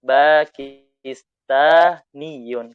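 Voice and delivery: "Pakistaniyun" drawn out very slowly, doubled ya stressed; slow model word. Shown as a demonstration. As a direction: drill "Pakistaniyun" carefully. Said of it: "bahasa Arabnya Pakistaniyun".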